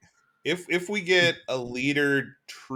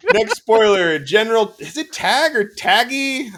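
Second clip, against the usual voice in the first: excited announcer; 11 semitones higher, 11 dB louder, slower than usual. Real, not acted. Announcing next card, hyped.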